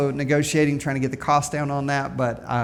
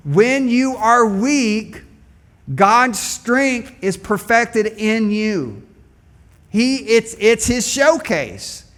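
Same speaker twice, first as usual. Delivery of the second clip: slow, higher, louder preaching; preaching at full force; 6 semitones higher, 4 dB louder, much slower than usual. Acted. Preacher proclaiming to the congregation, slow and raised. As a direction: lift it up and drive it home.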